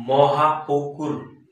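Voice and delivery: "Mahapukur" drawn out syllable by syllable; slow syllable dictation. Shown as a demonstration. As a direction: dictate "Mahapukur" slowly, separating its parts. Teacher dictates example word by syllables. ("Mahapukur" ভেঙে ভেঙে, ধীরে)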